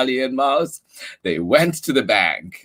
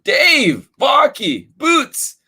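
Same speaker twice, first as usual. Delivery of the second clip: high, loud calling shout; 5 dB louder, slower than usual. Acted. Charlie calls a guessed name to the dog, high shout. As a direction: call the dog across the street. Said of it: "shouted Charlie".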